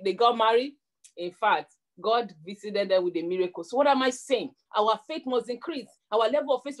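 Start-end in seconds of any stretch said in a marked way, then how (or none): none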